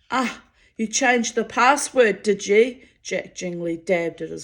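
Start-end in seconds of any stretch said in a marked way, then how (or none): 0.1-2.8 angry. A narrator's reading. Wounded giant confronts sentry.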